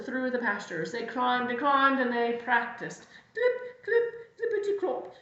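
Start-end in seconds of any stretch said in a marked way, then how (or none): 3.3-5.1 very high clip-clop; dancing hoof sounds. Acted. Goat practises while climbing, high chirped clip-clop. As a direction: chirp each hoofbeat high and separate.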